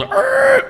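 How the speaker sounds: high strained grunt; a sudden high yelp; growl of effort. Retold or imitated. Father-in-law pulls the car.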